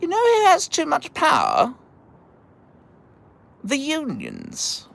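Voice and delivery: high-pitched mock politician voice; Margaret Thatcher. Imitating the politician the labor family hated, mock high British voice. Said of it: "Margaret Thatcher rolls into town, and she's like".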